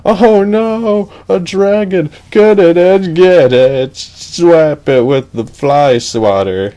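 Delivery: high, loud shrieking panic; panicked princess. Acted. Princess begging Edge to kill the dragon, high loud panic. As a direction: squeal for help, then slow down at the end.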